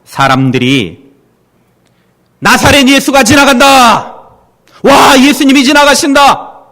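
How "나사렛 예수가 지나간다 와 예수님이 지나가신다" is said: high, loud excited crowd shout; crowd calling out. Retold or imitated. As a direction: call out the news to everyone. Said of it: "소리를 하니까". (높고 크게 외치는 군중)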